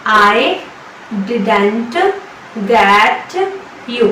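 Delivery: each word split by pauses; slow word by word. Shown as a demonstration. Teacher breaking the sentence into words for students. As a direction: drill word by word. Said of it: "इसकी English होगी".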